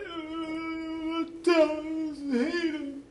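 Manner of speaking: put-on high, slow, tearful child's cry. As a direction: wail it like a hurt little boy. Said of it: "he started crying".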